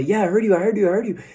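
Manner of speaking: put-on impatient kid. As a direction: kid waving mom away. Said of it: "kind of in that classic ... little kid moment".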